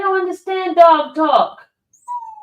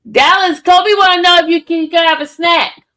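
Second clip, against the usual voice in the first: loud call across the house; shouting to Dallas in the other room; calling out to another room; 4 semitones higher, 8 dB louder than usual. Real, not acted. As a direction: raise your voice so she hears from the next room.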